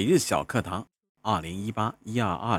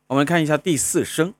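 ordinary delivery

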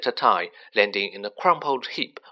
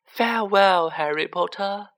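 parting elf; 8 semitones higher than usual. A narrator's reading. Dobby bidding Harry farewell, high and emotional. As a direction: call it high, then let it fall away. Tearful elf says goodbye.

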